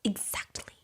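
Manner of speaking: whispered smug agreement. Retold or imitated. Zizi smugly agreeing, in a whisper. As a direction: breathe it out in a whisper.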